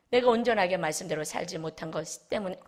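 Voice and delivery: ordinary talk, nothing marked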